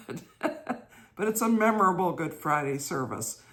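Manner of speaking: laughing. Real, not acted. Laughing at memory.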